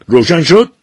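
father repeating it in a yell; shouted question. A narrator's reading. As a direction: bellow the repeat. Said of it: "داد زد".

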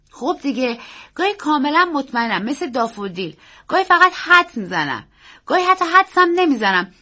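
put-on high, loud child's voice; confident boy. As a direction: explain eagerly, like a boy sure of himself. Boy explains to uncle.

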